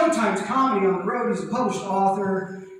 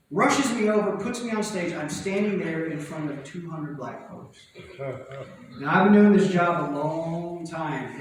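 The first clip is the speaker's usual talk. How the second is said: ordinary delivery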